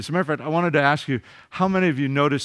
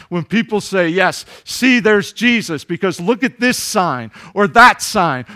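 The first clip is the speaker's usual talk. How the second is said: loud, rising pulpit delivery building in force; fervent preaching; 4 semitones higher, 5 dB louder than usual. Acted. Pastor preaching to his congregation, fervent and loud. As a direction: preach it out to the back pews.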